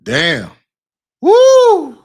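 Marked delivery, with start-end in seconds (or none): 1.2-2.0 very high, very loud woo; big shocked whoop. Real, not acted. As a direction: let out a piercing, held woo. Reacting to the played clip.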